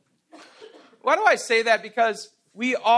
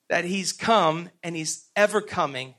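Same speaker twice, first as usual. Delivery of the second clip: normal talking voice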